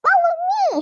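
high, excited squeal; sudden high squeal. A narrator's reading. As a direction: squeal it to her sisters. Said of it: "she squealed".

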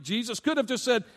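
ordinary delivery

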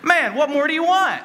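high, laughing mock exasperation; exasperated. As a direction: throw up your hands at God. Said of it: "Like, I'm thinking".

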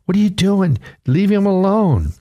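scolding crowd. Retold or imitated. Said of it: "they were saying".

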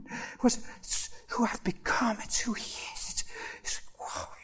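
breathless, unvoiced words fading to nothing; too moved to speak above a whisper; overcome whisper. Real, not acted. Choked up recalling the hug.

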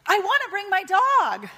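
put-on a girl shouting up, half laughing; little girl yelling. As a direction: yell up from the bottom of the pile. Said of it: "she yells up to Jesus".

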